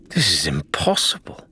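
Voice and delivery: whispered croak, awestruck. Acted. Adelaide to the crew before the treasure, croaking. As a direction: barely get the words out, hoarse and hushed. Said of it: "she croaked".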